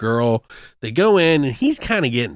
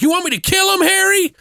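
much higher, louder menacing-trucker parody; mock eager trucker; 3 dB louder than usual. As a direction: pitch way up, eager to do the dirty work. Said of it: "he's like".

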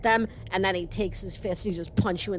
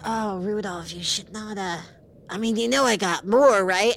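sly menacing villain voice; taunting, playful threat; character voice. Acted. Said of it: "And he's like".

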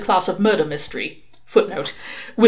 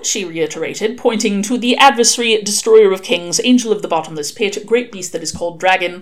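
ordinary delivery, nothing marked

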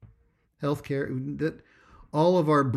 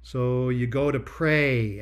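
ordinary talk, nothing marked

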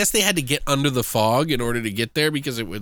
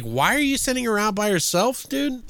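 incredulous; 7 semitones higher than usual. Retold or imitated. Questioning the movie's choice.

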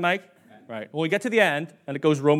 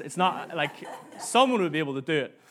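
ordinary talk

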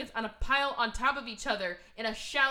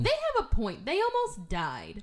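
pleading their case; 3 semitones higher, 6 dB louder than usual. Retold or imitated. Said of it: "I'm like".

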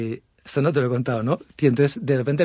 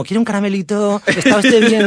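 mock patronizing; 6 semitones higher, 6 dB louder than usual. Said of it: "me trataban como si fuera gilipollas".

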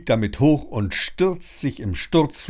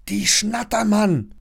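high, tense hiss, still voiced; hissed warning; 10 semitones higher, 3 dB louder than usual. A narrator's reading. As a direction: hiss the name in dismay. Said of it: "zischt Lilly".

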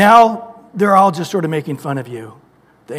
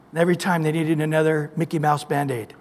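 ordinary talk, nothing marked

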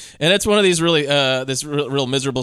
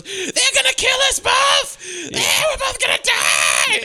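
very high, drawn-out panicked wail; mock hysterical screaming; slower than usual. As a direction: imitate a woman screaming her head off. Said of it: "screaming her head off".

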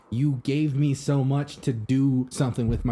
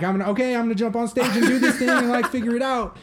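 excited inner voice; 9 semitones higher, 3 dB louder than usual. Retold or imitated. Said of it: "and I'm like".

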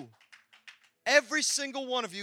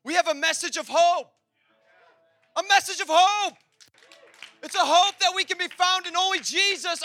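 louder, higher preacher's climax; preaching crescendo; 8 semitones higher, 8 dB louder than usual. Acted. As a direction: lift it to a shouting peak.

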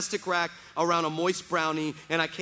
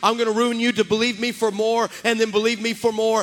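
loud, driving, repetitive build to a shout; relentless preacher's crescendo; full-force preaching; 3 semitones higher, 7 dB louder than usual. Retold or imitated. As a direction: voice God's declaration with mounting force. Preaches God's answer at full force.

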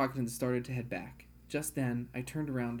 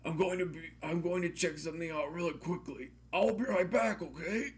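called out; 5 semitones higher than usual. Acted. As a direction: call across the room. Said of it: "I called out".

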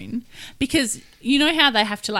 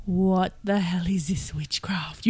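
whispered awe; 2 semitones lower, 6 dB quieter, slower than usual. Retold or imitated. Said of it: "they would just be like".

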